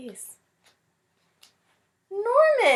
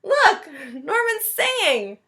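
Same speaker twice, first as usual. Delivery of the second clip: high, slow, laughing through the words; laughing delight; 7 semitones higher, 6 dB louder, slower than usual. Acted. Boy tells the teacher his fish is singing, laughing. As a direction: giggle out the news with delight.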